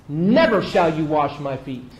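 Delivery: loud, slow refusal with NEVER punched; emphatic NEVER, falling firmly to the end; strong refusal. Acted. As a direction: launch hard on NEVER and draw it out. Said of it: "why such a strong response".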